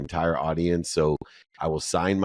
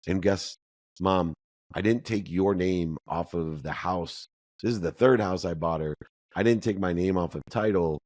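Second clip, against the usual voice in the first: ordinary talk, nothing marked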